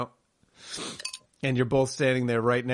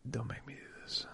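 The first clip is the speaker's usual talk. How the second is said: whispered plea; 3 semitones lower, 18 dB quieter than usual. Acted. Mock plea, last words whispered.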